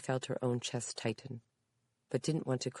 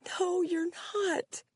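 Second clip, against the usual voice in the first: high, slow, tearful plea; crying; 5 dB louder, much slower than usual. A narrator's reading. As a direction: cry it out to her. Daughter crying.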